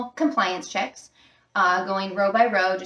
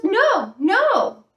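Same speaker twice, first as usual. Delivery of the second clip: put-on higher and louder, a girl's quick denial; high insistent denial; 9 semitones higher, 5 dB louder than usual. Caught girl denies smoking to the attendant, high and loud. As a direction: squeak out a defensive no. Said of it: "she goes".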